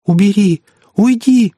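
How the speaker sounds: high, panicky cry; pitiful high-pitched pleading; frightened plea. A narrator's reading. Frightened boy backs away pleading.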